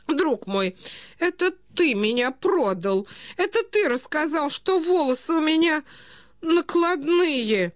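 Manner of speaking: put-on high, reproachful old prince; betrayed old prince. A narrator's reading. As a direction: reproach a friend feebly, pitch climbing. (старый князь, высоко, с обидой)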